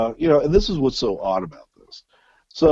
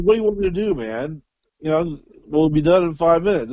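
exasperated; 7 semitones higher than usual. Retold or imitated. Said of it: "I'm like".

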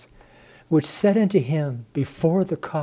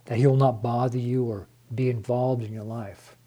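ordinary talk

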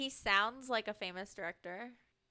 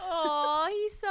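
high, drawn-out, louder aww; cooing; 6 semitones higher, 4 dB louder, slower than usual. Real, not acted. Co-host reacting to Guthrie's excitement, high cooing. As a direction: gush as if over something adorable.

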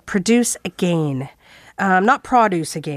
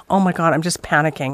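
ordinary talk, nothing marked

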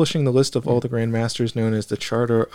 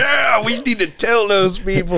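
mock zealot; 10 semitones higher, 4 dB louder than usual. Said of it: "You're like".

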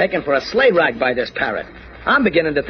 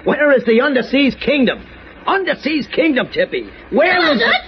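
insistent questioning that climbs higher; impatient demand; 9 semitones higher than usual. Acted. Candy questions the parrot about the kingdom, impatient demand. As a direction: press harder with every phrase. Presses the silent parrot for answers.

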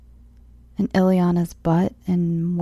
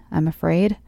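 ordinary delivery

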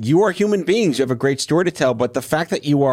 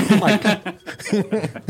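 laughing; 8 semitones higher, much slower than usual. Real, not acted. Laughing at the impression.

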